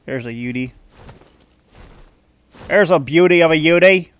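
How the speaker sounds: Aussie accent attempt; Australian accent. Spotting a Yudi, Australian accent. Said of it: "I wanna do the accent right".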